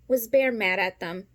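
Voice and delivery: usual speaking voice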